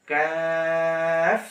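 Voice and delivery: কাফ light and thin, slowly held; Arabic letter kaaf. Shown as a demonstration. Teacher showing light কাফ against heavy কফ. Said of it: "কাফ উচ্চারণ হবে বারিকভাবে".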